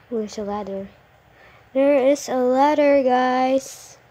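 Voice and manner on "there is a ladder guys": loud excited call. Real, not acted. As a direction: announce it loudly. Loud happy find.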